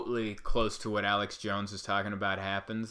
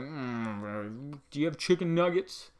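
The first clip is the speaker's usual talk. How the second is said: put-on unimpressed little kid asking for nuggets; mock picky kid; 4 semitones higher, slower than usual. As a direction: shrug off the fancy food like a child. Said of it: "and I'd be like".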